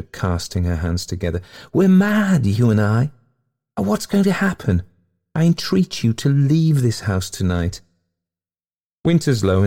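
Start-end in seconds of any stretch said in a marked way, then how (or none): 1.7-7.8 high-pitched, swept-away ghost lady; rapturous. A narrator's reading. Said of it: "she agreed with rapture".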